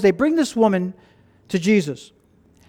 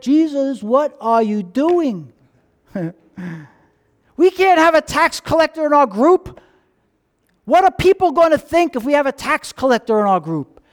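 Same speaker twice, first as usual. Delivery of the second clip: indignant disciples; 5 semitones higher than usual. Acted. Disciples protesting Jesus' choice.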